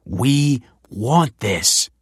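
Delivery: a man begging. A narrator's reading. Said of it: "begged the man".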